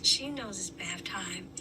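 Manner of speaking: whisper. Real, not acted. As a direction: keep it hushed, a secret. Whispering while cats hide.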